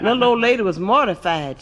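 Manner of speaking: normal talking voice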